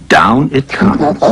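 usual speaking voice

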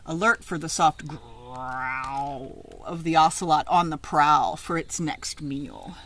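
lively picture-book reading; read-aloud story. Acted. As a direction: read it out to a class of children.

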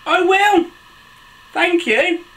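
very high-pitched, eager reply; cheery story character. Acted. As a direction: answer in a high little character voice. Story characters answer and thank.

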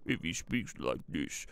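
low imitation of a grumbly villain; imitating deep voice. Boss showing Larry how the caller sounds, imitated deep voice. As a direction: put on the threatening caller's deep voice. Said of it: "he'll probably speak like this".

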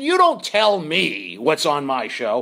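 slow, deliberate boss laying down the law; slow bossy lecture. Retold or imitated. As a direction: spell it out to the staff slowly. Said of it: "I said".

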